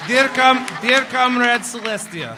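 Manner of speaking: loud, high mock Russian accent, Twilight as a Russian; hammed-up Russian accent dictating a letter. Moderator playing Twilight Sparkle as a Russian, dictating a letter in a hammed-up Russian accent. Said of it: "I can do a Russian accent".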